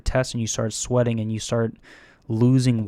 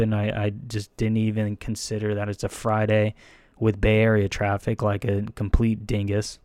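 usual speaking voice

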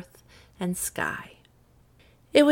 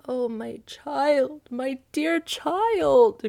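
very slow, higher anguished cry; crying father; 7 semitones higher, 4 dB louder, much slower than usual. Acted. Midas crying for his golden daughter, slow and high. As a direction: draw out the grief for his child. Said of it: "cried poor Midas".

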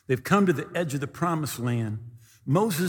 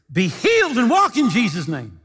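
commanding call; 6 semitones higher, 5 dB louder than usual. Retold or imitated. Healer commands crippled man.

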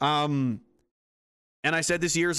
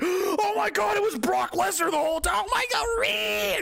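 much higher shocked shriek. Retold or imitated. Activists discovering Brock Lesnar, shocked shriek. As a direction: shriek in disbelief. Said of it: "they're like".

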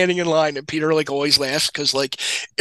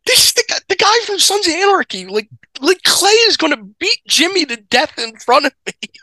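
high, excited disbelief; star-struck panic; 11 semitones higher than usual. Retold or imitated. Friend retelling meeting an actor, high panicked disbelief. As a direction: voice jumps way up in starstruck alarm. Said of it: "I'm like".

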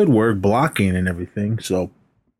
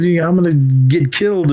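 mock panicked complaint, higher; Daniel; 7 semitones higher than usual. Daniel complains to Miyagi about the deal, mock alarm. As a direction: imitate a worried kid protesting. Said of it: "And Daniel's like".

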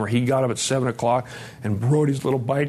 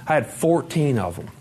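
normal talking voice

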